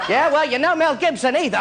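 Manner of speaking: put-on high, cheeky British kid; British child's voice. British child answers the Elephant Man, high cheeky retort. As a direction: talk back like a British schoolchild. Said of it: "doing it in a British children's voice".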